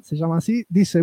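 ordinary talk

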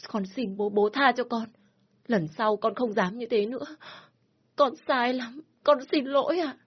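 high tearful begging; crying plea. Acted. Daughter begging her father to stop the beating, crying. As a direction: beg through tears. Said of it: "cô bắt đầu khóc lóc".

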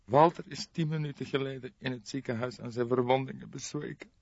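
a grieving woman delivering the death news; grieving young woman. Acted. Jolanda tearfully reports death. (bedroefde jonge vrouw, hogere stem)

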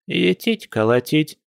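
high-pitched granny outrage; indignant old woman. Acted. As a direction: exclaim high, like a scandalised granny. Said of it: "возмутилась старушка".

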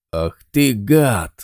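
loud, slow furious shout; angry yell. A narrator's reading. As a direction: roar it at the traitor. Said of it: "заорал Буля".

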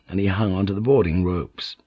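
plain reading voice

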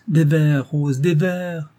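high, loud, drawn-out chant of demand; clamouring. A narrator's reading. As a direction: call slowly and insistently for an encore. Guests clamour again for verses.

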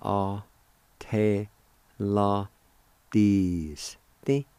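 A, te, la, dis, te with a gap after each; syllable by syllable. Shown as a demonstration. Teacher showing how join is pronounced, syllable by syllable. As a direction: sound out "A te la dis te" piece by piece. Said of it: "It's pronounced like this".

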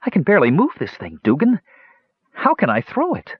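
helpless high-pitched straining; gasped. A narrator's reading. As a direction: protest in rising dismay at the weight. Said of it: "Palin gasped".

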